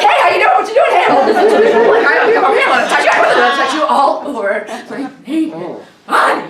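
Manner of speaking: high, loud swarm of chatty flies; hammed-up pushy insect voices crowding in; pestering flies. Disturbed kelp flies greeting their victim, high pestering chatter. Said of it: "they come out like".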